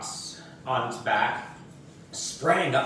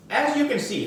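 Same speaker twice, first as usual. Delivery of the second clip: higher teacher voice; lightly voiced schoolteacher; a hint of prim teacher; 12 semitones higher, 3 dB louder, faster than usual.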